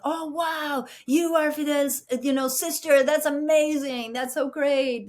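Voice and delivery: high-pitched imitated awe; gushing amazement. Schoolboys meeting her brother's sister, imitated gushing amazement. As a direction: imitate impressed boys gushing. Retold boys' admiring reaction.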